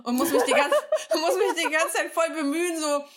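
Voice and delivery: giggly, trying not to slurp; laughing while drooling. Real, not acted. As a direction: laugh through the words, mouth full of spit. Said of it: "ich sabber voll und muss mich ... bemühen, so leise zu schlucken".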